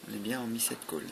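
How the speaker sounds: ordinary talk, nothing marked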